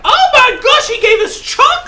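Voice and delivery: very high, loud squeal; overjoyed shriek; thrilled squeal. Real, not acted. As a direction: squeal with delight. Finds chocolate in package.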